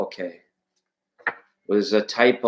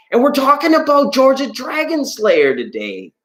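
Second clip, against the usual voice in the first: dramatic announcer; 12 semitones higher than usual. Acted.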